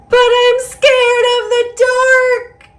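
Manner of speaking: the fear peaks here; scared. Acted. Fish admits fear, refrain.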